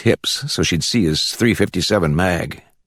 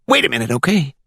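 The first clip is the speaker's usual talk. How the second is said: much higher Glades country voice; pitched-up rural Florida informant; country accent; 8 semitones higher than usual. A narrator's reading. Caller offering the judge a fugitive, country accent. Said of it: "With that country accent you heard in the Glades".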